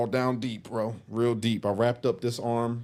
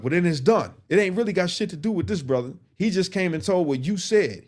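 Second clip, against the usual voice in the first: put-on firm old head; 8 semitones higher than usual.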